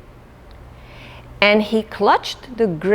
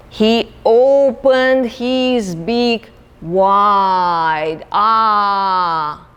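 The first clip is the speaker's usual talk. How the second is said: drawn out slowly, beak opening wide; slow wide-mouthed stretch; much slower than usual. Acted. Storyteller to children, slowly acting out the wide-open beak.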